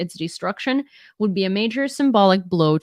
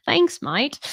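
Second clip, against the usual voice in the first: bad Australian accent, pitched higher; a jokey Aussie "mate"; hammed-up Australian accent; mock Australian accent; 8 semitones higher than usual. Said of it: "That was probably a horrendous Australian accent".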